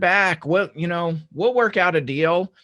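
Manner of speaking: high, slow, slick mock-friendly voice; evasive fake reassurance. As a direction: parody the sellers' smooth empty promises, pitched high. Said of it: "it's this evasive".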